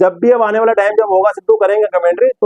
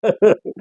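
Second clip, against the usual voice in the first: short laugh. Real, not acted. Presenter tickled by Sidhu's groin joke, short laugh. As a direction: chuckle after your own punchline.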